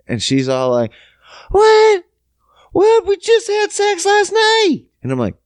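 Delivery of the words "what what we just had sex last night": very high, incredulous mock squeal; mock her disbelief in a squeaky high voice; outraged girlfriend. Girlfriend learns what he was doing, squealed outrage.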